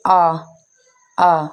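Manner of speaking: आ as a long open "aa"; letter sound demo. Shown as a demonstration. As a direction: sound out आ slowly for learners. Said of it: "सबसे पहली sound जो है".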